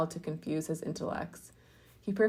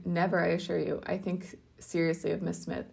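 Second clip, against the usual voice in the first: plain reading voice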